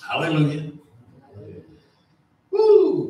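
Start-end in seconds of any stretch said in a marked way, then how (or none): none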